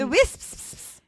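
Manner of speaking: high Scottish voice, hissed final s; put-on Scottish with lingering sibilants; Scottish accent. Shown as a demonstration. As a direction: lean on the "Wisps" in Scottish. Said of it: "you gotta do it for the people".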